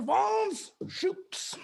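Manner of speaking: high, slow, stretched-out name; drawn-out high call. Pretend.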